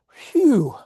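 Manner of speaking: high, loud exhaled "phew"; relieved phew. Acted. Child surviving the endless prayer, exaggerated relief. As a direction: let out an exaggerated sigh of relief.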